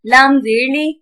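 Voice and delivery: measured sing-song spelling, long held final syllables; Qaida spelling drill. Shown as a demonstration. Teacher spelling tanween words aloud for children, sing-song. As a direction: chant each letter and sound like a reading drill.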